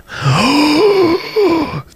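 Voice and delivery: long high-pitched mock gasp; hammed-up gasp of shock. Host feigning shock at a skipped booster, mock gasp.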